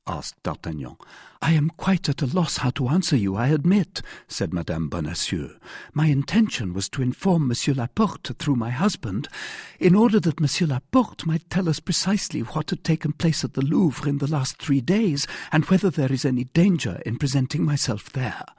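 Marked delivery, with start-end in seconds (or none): 1.4-4.0 higher-pitched woman's voice; perplexed heroine. A narrator's reading. Wife has no answer for her rescuer, perplexed. Heroine admits she is lost. 5.9-18.5 high-pitched female character explaining; earnest heroine. A narrator's reading. Wife explains her intention to her rescuer, earnest.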